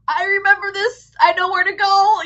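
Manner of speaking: high, loud eager recall; excited recognition. Retold or imitated. Touring the castle basement, high and loud with excitement. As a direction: say it like you suddenly remember the way. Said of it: "You're just like".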